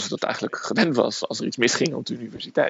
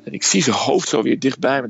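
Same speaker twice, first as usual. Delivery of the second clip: usual speaking voice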